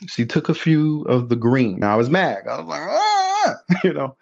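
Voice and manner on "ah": frustrated cry. Retold or imitated. Furious kid, money taken.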